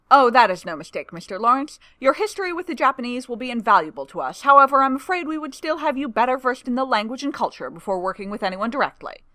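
booming official bonhomie; hearty older gentleman. A narrator's reading. Longstaff's hearty voice.

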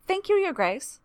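high, polite and sweet; lightly voiced pleasant clerk. A narrator's reading. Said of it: "says a pleasant voice".

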